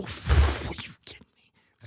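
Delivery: soft, awed whisper, played up; ASMR whisper. Host marvels at listeners' tingles, mock-whispered.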